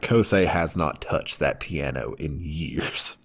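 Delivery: whispered aside. Real, not acted. Friend telling the co-host an aside, whispered. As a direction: hush it like a secret. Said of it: "whispered aside".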